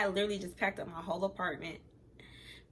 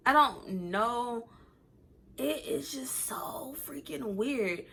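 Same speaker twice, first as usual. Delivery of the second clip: slow, hushed, half-whispered; embarrassed hush; 2 semitones higher, much slower than usual. Real, not acted. Telling viewers she slept with him, hushed. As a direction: whisper it like a guilty secret.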